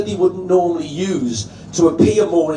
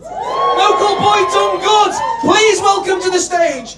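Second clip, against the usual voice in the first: much higher, shouted big introduction; announcer hype; 12 semitones higher than usual. Real, not acted. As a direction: bring the act on like a boxing announcer. Introducing first act.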